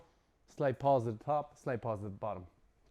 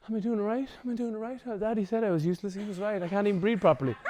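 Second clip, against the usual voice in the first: higher, fretful mock client voice; anxious self-doubt; 6 semitones higher, faster than usual. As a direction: fret like a nervous beginner who fears failing. Imitating an anxious breathwork client.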